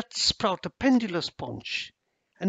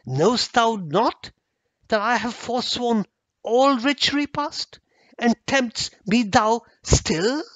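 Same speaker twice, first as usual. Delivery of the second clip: angry outburst; 6 semitones higher, 5 dB louder than usual. Acted. Lord rebuffs the servant.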